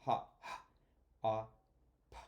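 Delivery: "Hop" split into its sounds: "h", "ah", "p", each on its own; breathy isolated phonics sounds; sounding out. Shown as a demonstration. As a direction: segment the word sound by sound for kids. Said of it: "count the sounds".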